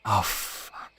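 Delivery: hushed despairing curse; whispered despair. Pretend. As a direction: breathe it out in despair. Despair at sentence.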